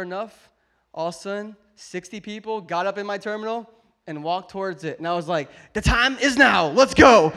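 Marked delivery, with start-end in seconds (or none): 5.7-7.3 loud, triumphant call to move out; hammed-up battle cry to board; mock rallying cry. Dad retelling the moment everyone lined up, loud rallying cry.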